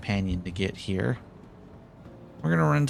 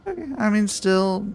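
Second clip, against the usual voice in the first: surprised; 11 semitones higher, 4 dB louder than usual. Real, not acted. Surprised reaction after landing.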